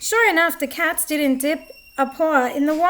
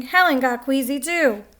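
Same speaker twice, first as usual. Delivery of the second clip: plain reading voice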